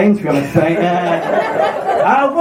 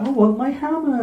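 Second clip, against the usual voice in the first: small whiny retold protest, pitched up; mock plaintive; 4 semitones higher, 4 dB quieter than usual. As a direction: softly bemoan it, imitating a sulky god. Thor sulking about his hammer.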